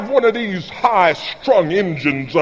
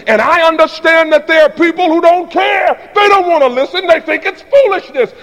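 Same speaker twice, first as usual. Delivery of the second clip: loud, much higher, hammering each word; preaching at full force; 9 semitones higher, 5 dB louder than usual. Real, not acted. Preacher shouting a warning to the congregation, full force. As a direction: preach it hard.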